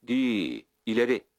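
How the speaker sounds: rising call urging the horses on; shout to horses. A narrator's reading. Andreşko shouting at the horses, loud call. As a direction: drive the horses forward with a loud cry. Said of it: "diye bağırdı".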